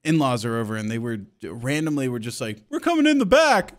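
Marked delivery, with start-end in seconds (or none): 2.7-3.7 loud, high imitated holler; mock announcement hollered through the house; calling out. In-laws announce they're arriving, high loud call.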